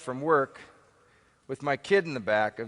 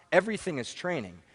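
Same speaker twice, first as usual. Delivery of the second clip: ordinary talk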